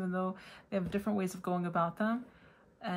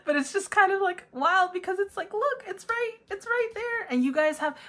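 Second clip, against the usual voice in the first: excited; 11 semitones higher, 4 dB louder than usual. Real, not acted. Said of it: "I don't know why I'm so excited".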